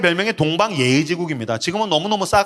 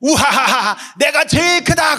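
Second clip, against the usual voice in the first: higher, slow triumphant shout; boastful child; 9 semitones higher, slower than usual. Retold or imitated. Father imitating his daughter on the chair, gleeful shout. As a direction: crow like a five-year-old on a chair. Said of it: "그러는 거예요".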